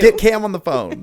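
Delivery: mock demand. Mock demand after name mix-up.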